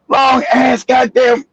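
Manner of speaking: ordinary talk, nothing marked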